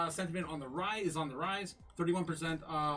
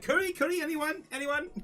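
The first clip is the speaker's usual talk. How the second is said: mock calling out; 11 semitones higher, 3 dB louder than usual. Mock teasing call in chat.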